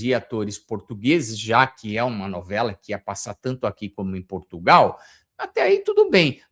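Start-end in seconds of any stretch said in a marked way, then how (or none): 5.4-6.4 sarcastic ok. Real, not acted. Ironic concession before criticism.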